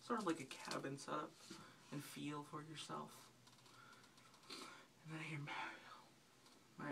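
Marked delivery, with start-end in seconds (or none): 4.5-6.9 slow whispered reveal; hushed reveal. Real, not acted. Building suspense about hearing Mario, slow whisper. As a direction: whisper it slowly, like a suspense beat.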